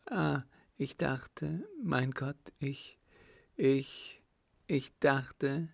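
high, halting fearful stammer; acted frightened niece, hesitant pauses; too afraid to continue. A narrator's reading. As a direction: falter and break off anxiously. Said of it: "Weiter konnte sie nicht vor Angst".

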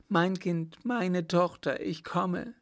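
put-on high, distressed cry to a child; frantic mother. A narrator's reading. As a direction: call out in maternal panic. Frantic mother rushes to her daughter. (verzweifelt und hoch gerufen)

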